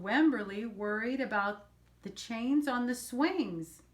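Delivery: plain reading voice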